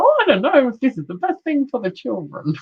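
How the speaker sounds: high, fretful put-on voice of a worried mum; mock worried mum. Medium sends up her own excuses to the client, high fretful mum voice. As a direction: whine it like a mother talking herself out of her dream. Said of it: "Jenna will go".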